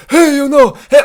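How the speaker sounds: high, loud screamed greeting; screaming Merab. Shown as a demonstration. Showing how he must scream Merab, high and loud. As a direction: yell it up high. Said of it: "I kinda have to scream it".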